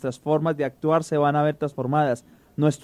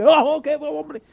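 much higher, excited cry at the play; excited baseball fan; 12 semitones higher, 4 dB louder, slower than usual. Retold or imitated. Relative at the game yelling at the play, excited. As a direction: squeal it like a fan outraged at a call. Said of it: "Y que así, que".

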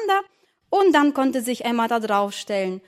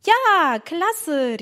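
high, delighted exclamation; excited cry; 6 semitones higher than usual. Acted. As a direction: cheer with childish delight. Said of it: "rief Emma". (begeistert gerufen)

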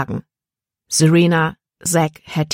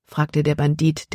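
plain reading voice

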